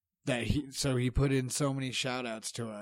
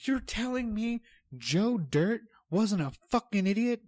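high, loud, drawn-out exasperation; worked up and incredulous; incredulous rant; 9 semitones higher, 5 dB louder, slower than usual. Real, not acted. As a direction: argue it loudly, leaning on each word. Heated rant about comedy movie characters.

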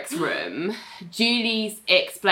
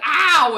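high, loud mock ow; pained yelp; 8 semitones higher, 5 dB louder than usual. Ling being yanked out by Ali, mock pained yelp.

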